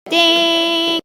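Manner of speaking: usual speaking voice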